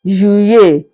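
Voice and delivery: Juillet said zhoo-yay; French pronunciation. Shown as a demonstration. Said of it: "pronounced as Juillet".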